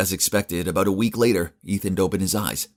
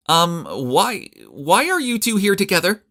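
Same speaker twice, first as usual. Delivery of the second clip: much higher, flustered stammer; startled; 13 semitones higher, 5 dB louder, slower than usual. A narrator's reading. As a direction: caught off guard, stammering high. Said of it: "almost fell off her chair in surprise".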